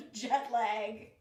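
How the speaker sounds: laughing at her own slip; chuckling through the correction. Real, not acted. Laughs at own jet lag slip.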